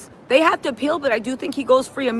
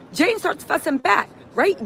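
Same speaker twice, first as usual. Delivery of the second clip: ordinary talk, nothing marked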